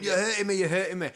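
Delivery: half-hearted high yell; retold shouting. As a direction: imitate a man yelling while arrested. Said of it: "really shouting and going".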